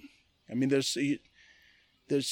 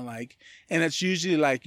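usual speaking voice

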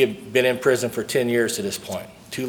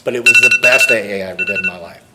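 loud, pressing hard on "was" as if preaching; emphatic proclamation; 8 dB louder than usual. Acted. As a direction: declare "but it was the best A A I ever did" loud to the hall. Proclaims his best AA experience.

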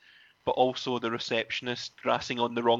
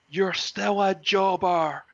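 slow, loud, higher put-on voice of the caller; slow mock taunt; 7 semitones higher, 5 dB louder, slower than usual. Phone caller taunting Matt, slow mocking voice. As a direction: drag it out like a sneering insult.

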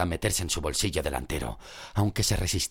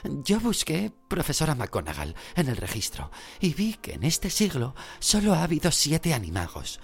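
eager know-it-all; 10 semitones higher than usual. Acted. Hermione reciting her research.

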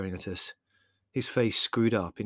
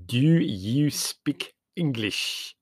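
slow, heavy, ominous question; ominous customs man; 4 semitones higher, 3 dB louder, much slower than usual. A narrator's reading. Customs man leaning over the counter, slow ominous. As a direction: lean in and drag each word. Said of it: "he said ominously".

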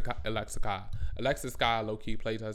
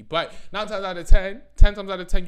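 loud, higher, hammering the count; nine times out of ten, then louder ten times; emphatic shout; 7 semitones higher, 13 dB louder than usual. Real, not acted. Correcting herself to her viewers, emphatic shout.

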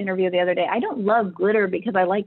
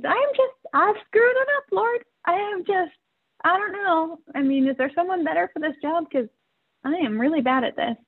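despairing prayer; 9 semitones higher than usual. Retold or imitated. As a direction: retell a desperate prayer in a thin, high voice. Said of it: "So I'm praying".

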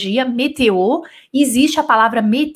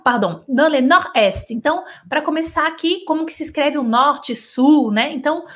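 usual speaking voice

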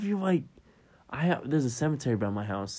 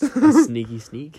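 slow, higher sneaky whisper-like drawl; 5 semitones higher, 9 dB louder, slower than usual. Acted. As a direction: creep the words out like a cartoon sneak. Playful sneaky voice.

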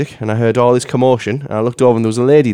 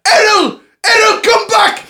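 very high imitated shout across a road; high, hammed-up yell of a dog owner; woman calling her dog; slower than usual. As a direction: call the dog back in a mock shriek. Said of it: "heard the woman over the road going".

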